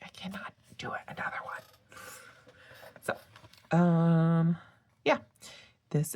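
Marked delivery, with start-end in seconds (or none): 0.0-1.6 soft whisper, half-joking despair; whispered refusal. Retold or imitated. Too much rose gold in her journal, whispered refusal. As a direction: breathe it out like a tired confession. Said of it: "and I'm like".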